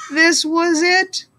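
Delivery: tense buildup. A narrator's reading. Tense moment before cheer.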